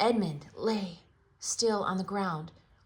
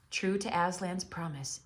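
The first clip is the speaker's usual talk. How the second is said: ordinary delivery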